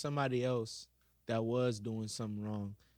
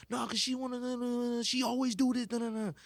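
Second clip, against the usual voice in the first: upset student; 10 semitones higher than usual. Retold or imitated. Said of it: "And I was just like".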